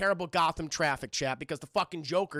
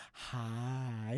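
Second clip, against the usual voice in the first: low, soft, sinister greeting; Joker; 8 semitones lower, 9 dB quieter than usual. Acted. Parked round the corner waiting for him, quietly menacing. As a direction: drop it low and creepy. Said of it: "just the Joker going".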